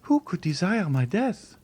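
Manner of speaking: put-on high, pleading young woman's voice; a terrified girl refusing to believe; frightened young girl. A frightened young Valentine begging the Count to leave her.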